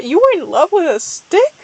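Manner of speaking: mock-shocked character voice; disbelieving. A narrator's reading. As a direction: ask it in exaggerated disbelief. Disbelieving character questions Jay Feather.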